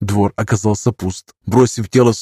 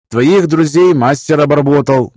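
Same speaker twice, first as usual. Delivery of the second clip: captain voice; 5 semitones higher, 4 dB louder than usual. A narrator's reading. Captain, cut off.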